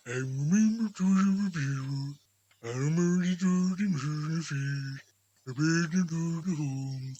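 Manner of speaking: slow, weighty ghost voice; solemn giant spirit. Acted. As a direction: spell out each phrase like a pronouncement.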